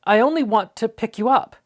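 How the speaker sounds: put-on gently coaxing, young and earnest; boy's voice. A narrator's reading. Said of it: "he said".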